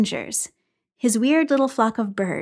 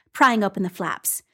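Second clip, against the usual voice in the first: plain reading voice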